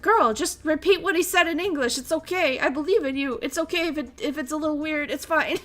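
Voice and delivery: it's-okay pep talk, pitched high; coaxing reassurance. Retold or imitated. Acting out encouragement to struggling translator.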